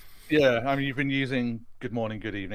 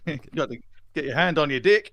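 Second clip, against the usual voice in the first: put-on South African accent; 5 semitones higher, 7 dB louder than usual. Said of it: "Why is there a South African accent?".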